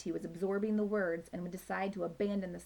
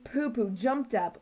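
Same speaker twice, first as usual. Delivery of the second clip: usual speaking voice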